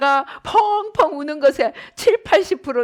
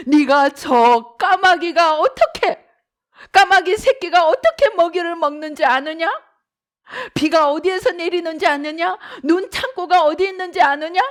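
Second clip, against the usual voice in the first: bombard him with questions from above; God's thundering questions; 5 semitones higher, 5 dB louder than usual. Acted. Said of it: "질문을 퍼부으십니다". (욥에게 질문을 퍼붓는 하나님의 우렁찬 목소리)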